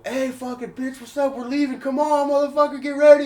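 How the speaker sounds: hyped-up mock wake-up yell; uncle's rousing shout. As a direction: holler like you're dragging someone out of bed. Uncle rousing him from bed.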